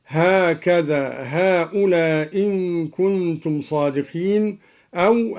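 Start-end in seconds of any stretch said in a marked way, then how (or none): 1.4-4.6 "هؤلاء إن" run into one hamza with a short "lā"; hamza dropped, short. Shown as a demonstration. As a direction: keep the long a of "هؤلاء" short and drop its final hamza before "إن". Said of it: "بإسقاط الهمزة". (إسقاط الهمزة في "هؤلاء إن" مع القصر)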